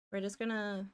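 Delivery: ordinary talk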